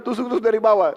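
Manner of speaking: urging shout. Acted. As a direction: push the crowd on. Bilal urging the crowd to stab.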